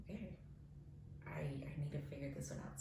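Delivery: hushed whisper to herself; whispered thought. Retold or imitated. Whispering to herself while deciding what to do. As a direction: whisper it like thinking aloud.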